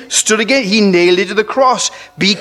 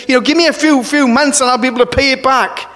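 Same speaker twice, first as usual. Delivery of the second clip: higher-pitched plea, imitating a debtor; lightly acted begging; appealing; 7 semitones higher than usual. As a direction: plead for a little more time. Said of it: "he appealed to him and said".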